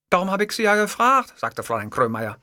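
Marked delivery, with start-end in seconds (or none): 0.1-1.4 high-pitched secretary retort. A narrator's reading. Secretary justifying her question to her boss, high retort. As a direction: answer back in a high secretary voice. Secretary answers, later repeats sniffling.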